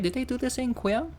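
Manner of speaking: excited question. Real, not acted.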